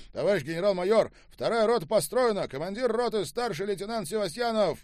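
loud, high-pitched formal report; military report. A narrator's reading. Lieutenant reports to the major general, loud military delivery. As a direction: report at full voice to a superior.

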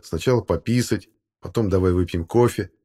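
ordinary talk, nothing marked

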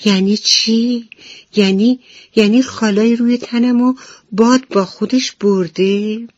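high, loud, frightened cry of disbelief; panicking about the lost spots; terrified. Acted. Ladybug fearing the wind took her spots, terrified. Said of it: "وحشت زده سوال کرد".

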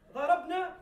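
ضربنا punched loud and high; emphatic word. Shown as a demonstration. Teacher stressing the verb to students, loud emphasis. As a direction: hit ضربنا hard to make the point. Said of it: "ض-، ضرب-،".

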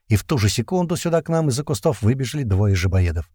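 usual speaking voice

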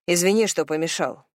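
plain reading voice

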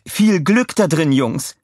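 high, bright well-wishing; friendly woman voice. A narrator's reading. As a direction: wish the boys luck. Madge wishes Minions luck.

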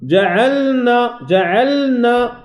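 جعلنا slow, the ل held a moment; held لام. Shown as a demonstration. Teacher modelling the medium hold on the ل of جعلنا. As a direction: linger on the ل in جعلنا. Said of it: "هذا الوقت هو المهم".

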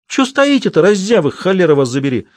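high shrill scolding yell; scolding shout. Acted. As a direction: bawl at the lazy women. Ustinya scolding the women. (сердитый окрик)

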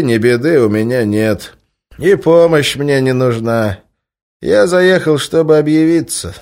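loud, high, firm refusal; firm loud reply. Acted. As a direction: state it firmly and loud. Stranger answers the boss firmly.

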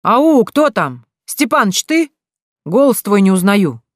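high, loud, drawn-out holler; calling out. A narrator's reading. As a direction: shout back to strangers hidden in fog. Unseen man shouts back through fog. (протяжно кричит издалека)

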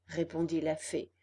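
ordinary delivery

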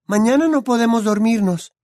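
put-on high, bright young witch voice; Hermione's voice. A narrator's reading. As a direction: voice the clever girl friend.

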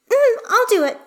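put-on very high little-girl voice; shy child. A narrator's reading. Hestia agrees to ask Astarion, high child voice.

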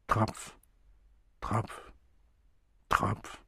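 fast tonlos, langsam tropfend; leise. A narrator's reading. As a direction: jedes Tropf wie ein fallender Blutstropfen hingehaucht. Dripping blood, sound mimicked. (Erzähler lässt das Blut tropfen, ganz leise)

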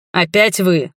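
sharp, irritated bark. A narrator's reading. Familiar voice on meeting her again, barking. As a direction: bark it in annoyance. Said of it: "рявкнул знакомый голос".

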